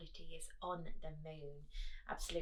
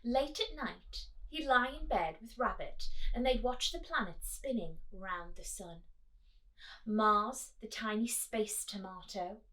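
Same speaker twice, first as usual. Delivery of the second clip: higher, animated storybook voice; reading to children; 4 semitones higher, 5 dB louder than usual. Acted. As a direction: draw the kids into the story. Reading picture book aloud.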